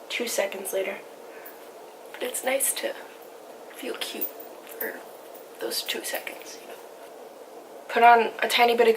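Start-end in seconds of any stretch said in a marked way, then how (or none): 2.1-6.7 whispered, self-mocking aside. Real, not acted. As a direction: drop to a whisper.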